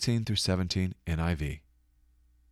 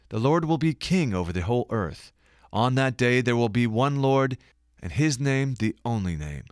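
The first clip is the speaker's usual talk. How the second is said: scripture reading; 3 semitones higher than usual. Acted. Reads prophecy aloud.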